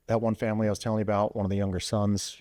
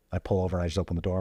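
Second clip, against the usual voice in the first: ordinary delivery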